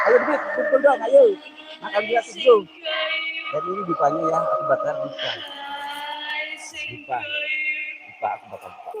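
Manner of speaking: hushed mock-spooky narration over burning offerings; hushed ritual voice. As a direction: murmur as if spirits might hear. Calling spirits, hushed.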